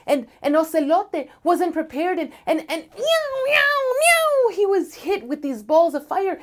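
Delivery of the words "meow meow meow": very high, loud yowling meows; pained cat yowls. Acted. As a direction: yowl as the fire hits. Cat hit by fireballs.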